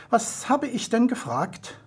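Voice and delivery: a lightly voiced, slow challenge; challenging. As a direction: test him, combative. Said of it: "Kampfbereit forderte es Lexus heraus".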